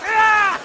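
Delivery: short mock scream; startled yell. Comic attacked by a dog at night, startled yell.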